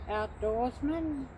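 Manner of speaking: ordinary talk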